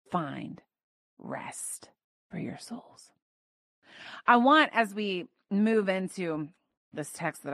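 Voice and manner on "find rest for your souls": very soft, whispered promise; preacher hushing to a whisper for Jesus' promise; hushed whisper. Acted. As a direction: drop to a whisper, slow and tender. Closing the quoted promise softly.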